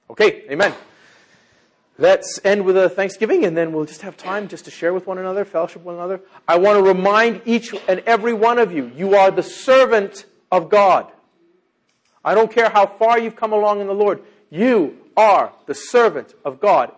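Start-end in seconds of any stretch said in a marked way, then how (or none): 6.5-16.9 loud, raised preaching voice, slowing on key words; emphatic charge. Real, not acted. Preacher reminds each one they are God's servant, emphatic charge. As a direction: hammer it home to every listener.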